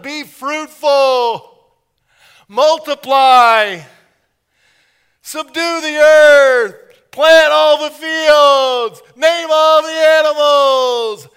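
God shouts his charges to the first couple; booming commands. Retold or imitated. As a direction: thunder each command. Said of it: "God was saying".

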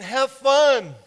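God cheers the couple on; cheering God. Retold or imitated. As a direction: urge them on warmly. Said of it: "God was saying".

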